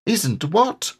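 small high timid piglet voice; tiny nervous animal voice; Piglet's small voice. A narrator's reading.

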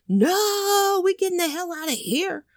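high, panicked exit line; high-voiced British couple fleeing; panicked tourists. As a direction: squeal that you are leaving. British couple wanting to leave.

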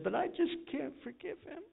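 imitating the wife's anguished plea; wife who can't forgive. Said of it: "And she said".

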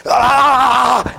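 loud, high fake scream of shock; hammed-up pained shout; mock electrocution yell. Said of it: "I started going".

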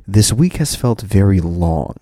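normal talking voice

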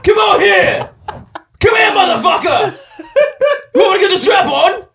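high, loud, shouted British geezer voice; hammed-up British shout; mock British accent. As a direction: yell it like a cocky English lad spoiling for a fight. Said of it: "whatever the fuck they say in England".

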